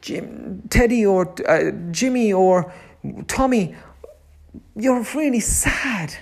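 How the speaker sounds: put-on gently name the child's sadness for them; soothing a crying child. Shown as a demonstration. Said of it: "we go down to the level and say".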